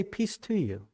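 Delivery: soft, hushed, calming "Peace to you"; a hushed, tender peace offered; gentle greeting. Retold or imitated. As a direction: settle frightened friends with a quiet blessing. Jesus greets the frightened disciples.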